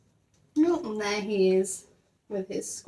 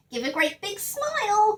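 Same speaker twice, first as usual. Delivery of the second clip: put-on high cheerful girl's voice; high sing-song coaxing for the camera; Judy coaxing; 13 semitones higher than usual. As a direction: coax a smile out of him.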